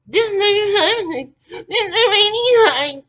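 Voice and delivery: very high, loud, dragged-out mock whine; mocking mimicry. Mimicking people wanting work done, high loud mocking whine. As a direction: imitate them in a slow, squealing put-on voice.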